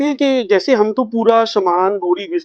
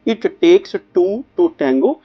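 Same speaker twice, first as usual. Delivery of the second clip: very slow, ending on a rise; slow proverb repeated; much slower than usual. Shown as a demonstration. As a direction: repeat it slowly, lifting at the end. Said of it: "ये एक south American proverb है".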